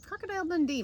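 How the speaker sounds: high mock Aussie; playful Australian film-title voice; Australian accent. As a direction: say it with an Australian twang. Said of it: "The Crocodile Hunter".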